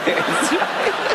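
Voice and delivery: very high squeaky suppressed giggle; imitating stifled giggle. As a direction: mimic a brother trying not to laugh. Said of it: "Larry eventually sort of started doing this".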